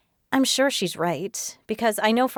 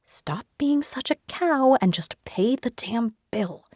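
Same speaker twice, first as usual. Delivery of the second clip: breathless angry whisper; whispered thought; 6 semitones higher than usual. Acted. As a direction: hiss it under your breath. Swallowed inner retort, whispered.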